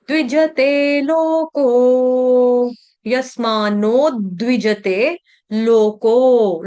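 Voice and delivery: यस्मान्नो द्विजते लोको slowly chanted with long vowels; verse recitation model. Shown as a demonstration. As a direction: recite द्विजते syllable by syllable, dv fused. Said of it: "द्वार वाला द लगा ना".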